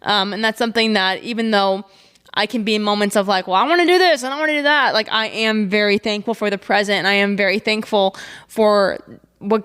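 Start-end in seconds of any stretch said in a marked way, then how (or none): 3.5-4.9 whiny impatience. Retold or imitated. Impatient wanting voice.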